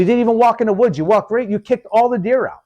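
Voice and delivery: high-pitched retold scolding; exasperated mock accusation; indignant confrontation. Retold confrontation with the other hunter.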